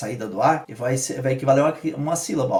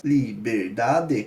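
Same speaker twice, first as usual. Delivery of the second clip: "Liberdade" said slowly, one beat per syllable; li-ber-da-de split slowly into syllables; 5 semitones lower, much slower than usual. Shown as a demonstration. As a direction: count the beats of "Liberdade" slowly.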